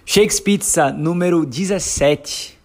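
slow, drawn-out show intro; announcer voice. Acted. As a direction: announce the episode title grandly. Podcast episode opening announcement.

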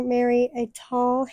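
ordinary talk

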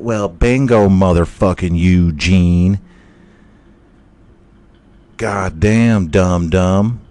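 loud mocking gloat. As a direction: crow over the win. Splat gloats, louder.

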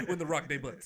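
deliberately botched debuts, ending in "butts"; DAY-butts mispronunciation. Retold or imitated. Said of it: "Is that better for you?".

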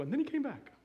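high, bright mock announcement of the happy ending; hoped-for triumphant line. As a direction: deliver the imagined redemption moment with a lift. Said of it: "I was waiting for her to go".

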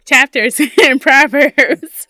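laughing through the words. Real, not acted. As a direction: laugh while correcting. Laughing correction.

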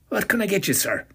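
put-on barman asking in a strong Cork Irish accent. A narrator's reading. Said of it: "he asked in a strong Cork accent".

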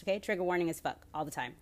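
ordinary delivery

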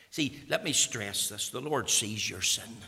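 ordinary talk

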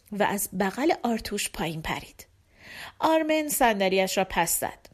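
ordinary delivery

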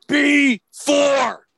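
high, projected bingo call; bingo caller's shout. Retold or imitated. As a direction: belt it out to the whole hall. Booming bingo call after soft caller.

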